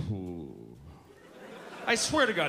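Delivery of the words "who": low, lazy lips-loose who; a word that just slides out; slack mumbled who. Retold or imitated. Comic copying the friend's lazy reply, low and slurred. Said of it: "that's how he said it".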